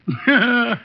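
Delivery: brief chuckle; amused laugh. Real, not acted. [name] laughing at Archie's confusion, fond chuckle. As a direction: laugh fondly at him.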